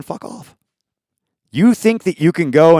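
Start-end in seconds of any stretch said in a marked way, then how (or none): 0.0-0.6 sudden whispered, much softer brush-off; whispered dismissal. Real, not acted. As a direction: drop to a whisper and spit it out. Dismissive aside mid-rant.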